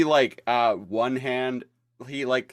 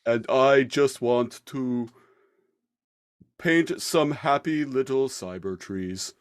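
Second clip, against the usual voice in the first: soothing painter voice; 3 semitones higher than usual. Retold or imitated. Bob Ross style parody.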